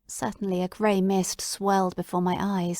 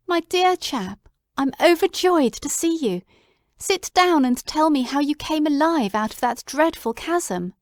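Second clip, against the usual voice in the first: overjoyed; 6 semitones higher, 3 dB louder than usual. Acted. Watson greeting his returned friend, higher and overjoyed. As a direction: gush with joy.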